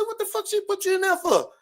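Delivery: outraged at the absurdity; high incredulous yell. Retold or imitated. Man asks brother why girlfriend locked him up, high disbelief. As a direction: voice shoots up in disbelief. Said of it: "I said".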